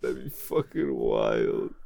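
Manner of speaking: very low, slow delivery; put-on low voice. Reacting to the silly casting idea, slow deep voice. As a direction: drop way down and draw it out.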